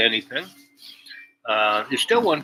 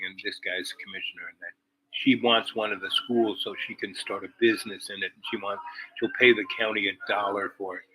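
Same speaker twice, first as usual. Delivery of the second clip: plain reading voice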